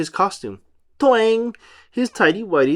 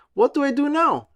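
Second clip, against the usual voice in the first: SpongeBob voice; 10 semitones higher than usual. Acted. SpongeBob flustered.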